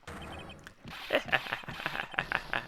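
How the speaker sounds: long hissing evil laugh; breathy villain laugh. Acted. Villain revealed.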